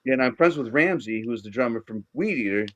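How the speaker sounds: usual speaking voice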